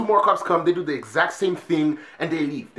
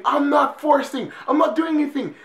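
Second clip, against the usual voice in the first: mock scream; 11 semitones higher, 4 dB louder than usual. Suspect pinned to the floor, mock screaming. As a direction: squeal it out, pleading innocence. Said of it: "He's screaming".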